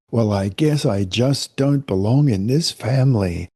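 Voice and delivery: ordinary talk, nothing marked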